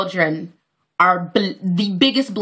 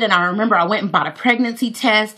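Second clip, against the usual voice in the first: normal talking voice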